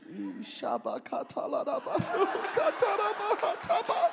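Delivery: high, loud mock tongues; mock speaking in tongues. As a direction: babble in tongues with a laugh. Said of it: "That was tongues for some of y'all".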